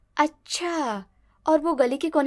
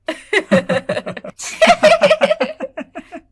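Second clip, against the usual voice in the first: loud, high burst of laughter; family laughing; 10 semitones higher, 6 dB louder than usual. Acted. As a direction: laugh hard at the gullible bride. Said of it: "सभी लोग हंसने लगते हैं".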